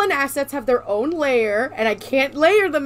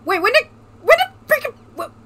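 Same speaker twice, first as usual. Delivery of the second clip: outraged disbelief; 3 semitones higher, 4 dB louder than usual. Real, not acted. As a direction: blurt it out in shock. Outraged exclamation.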